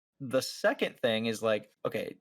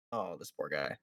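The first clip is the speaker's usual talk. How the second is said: quiet pity; 7 semitones lower, 9 dB quieter than usual. Retold or imitated. Own thought, pity.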